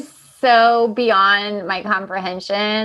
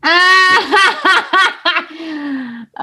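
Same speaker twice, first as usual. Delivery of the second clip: real loud, high burst of laughter; 7 semitones higher, 5 dB louder than usual. Real, not acted. Cohost reacting to friend's whispering story, loud laugh. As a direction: burst out laughing, loud enough to wake the dog. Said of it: "[name]'s laugh just woke up the dog".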